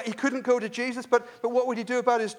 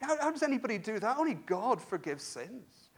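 ordinary talk

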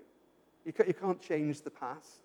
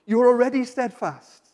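usual speaking voice

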